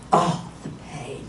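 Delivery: hushed, fading moan of pain; pained groan. Retold or imitated. Rolling over onto the swelling, soft pained groan. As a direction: let it sink away softly, as if hurting.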